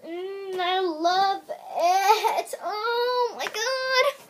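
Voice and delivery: high, very slow drawn-out rapture; swooning. Real, not acted. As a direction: stretch each word in delight. Swooning over the soap.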